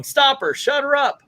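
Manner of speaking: high-pitched imitated yelling at normal volume; mock yell. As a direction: act out a yelled order, pitched high. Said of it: "as Tex is yelling".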